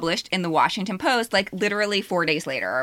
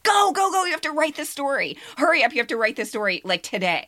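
urgent excitement; 7 semitones higher, faster than usual. Retold or imitated. Urging her friend to write the story now, excited. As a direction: hurry her on, thrilled. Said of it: "I was like".

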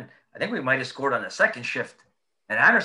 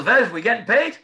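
laughing quote; 5 semitones higher, 7 dB louder than usual. Retold or imitated. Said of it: "He goes".

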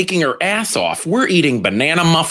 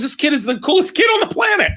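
fast, much higher, near-shouted praise; excited gush; 12 semitones higher, faster than usual. Real, not acted. Gushing about his son.

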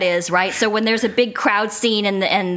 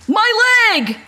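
high, loud comic cry; yelled out; 10 semitones higher, 4 dB louder than usual. Retold or imitated. Retelling a castmate's outburst in a crowd scene, yelled. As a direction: yell it out like a background cartoon character. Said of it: "Doug yelled out".

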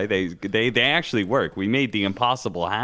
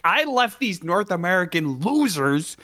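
mock Snaking rant; 6 semitones higher than usual. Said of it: "Snaking's like".

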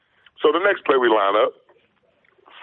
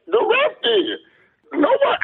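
high, raised retort; mock exasperated shout. Answering Lane Kiffin's sideline yelling, high and exasperated. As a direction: snap back at him.

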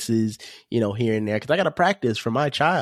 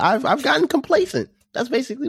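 squeaky high. Acted. Co-host mocks his own complacency.

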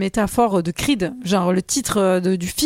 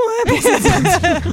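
laughing; 8 semitones higher, much slower than usual. Real, not acted. Joke about huge belt.

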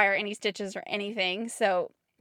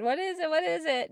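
higher, eager repeated question; exclaimed; 7 semitones higher than usual. Retold or imitated. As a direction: blurt it out in excitement. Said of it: "Adam got to exclaim".

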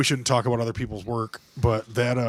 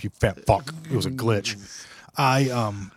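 ordinary talk

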